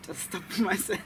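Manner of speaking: laughing at himself. Real, not acted. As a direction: giggle through the words.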